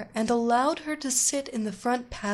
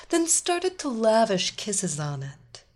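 ordinary delivery, nothing marked

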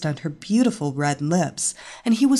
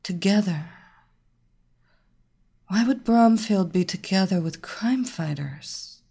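groaning like a possessed woman; 3 dB quieter than usual. Acted. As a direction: moan it out in a trance. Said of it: "groaned in an almost possessed voice".

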